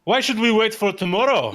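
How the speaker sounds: furious shout after reading the letter; angry outburst. Acted. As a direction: burst out, red-faced with rage. Enraged NPC after reading letter.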